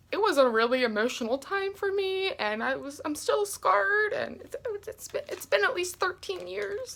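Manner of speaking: high, softening voice as if about to cry; hammed-up tearful recollection; mock tearful. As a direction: play up the lingering trauma. Jokes about still being scarred.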